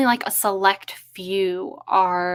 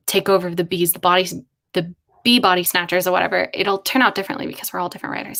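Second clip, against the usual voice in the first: ordinary talk, nothing marked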